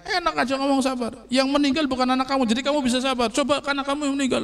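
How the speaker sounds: high, mock-indignant rant; imitated scolding. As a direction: act out a bereaved mother telling someone off. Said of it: "yang nasihat itu malah dimarahin".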